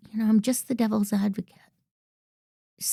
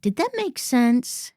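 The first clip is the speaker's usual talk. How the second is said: slow, higher, uncertain "Did that make sense?"; uncertain checking; 6 semitones higher than usual. Shown as a demonstration. Coach mimics a presenter unsure of herself, slow and uncertain. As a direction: seek reassurance. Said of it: "eliminate the sentence".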